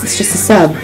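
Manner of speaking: usual speaking voice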